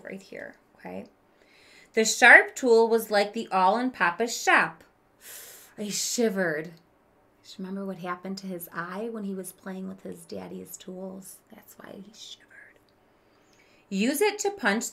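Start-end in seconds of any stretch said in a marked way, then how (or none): 1.9-6.7 lively read-aloud for a class; expressive story narration. Acted. Teacher reads story to class. 11.5-12.8 hushed aside; soft whispered aside. Real, not acted. Aside to class mid-story.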